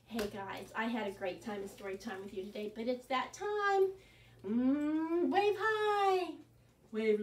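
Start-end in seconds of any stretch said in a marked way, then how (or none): none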